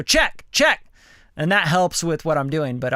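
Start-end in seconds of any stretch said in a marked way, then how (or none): 0.0-0.8 rising, louder check; mic check. Shown as a demonstration. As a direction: test the compressor with a call. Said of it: "I have a compressor on".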